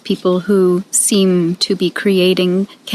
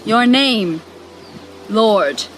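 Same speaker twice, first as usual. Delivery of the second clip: higher, dramatic spoken-word prayer with long pauses; 5 semitones higher than usual. Acted. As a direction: plead with God in measured, theatrical phrases. Performs her prayer monologue.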